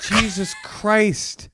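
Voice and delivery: high, very drawn-out shock; appalled exclamation. Real, not acted. Host reacting to a caller keying her sister's car, appalled. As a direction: stretch it out high in comic disbelief.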